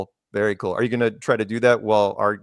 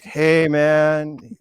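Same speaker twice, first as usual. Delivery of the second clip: lightly voiced stoned greeting; a hint of a stoner drawl; stoned man; 3 semitones higher than usual. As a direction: lazily imitate a spaced-out guy. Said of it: "high as a freaking kite".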